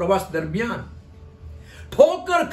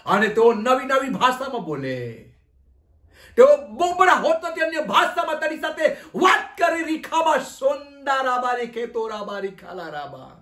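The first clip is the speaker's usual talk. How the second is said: high-pitched pleading spilling into tongues; fervent prayer; 4 semitones higher than usual. Real, not acted. Pastor praying they speak in new languages, fervent high prayer. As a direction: pray urgently and let it run into tongues.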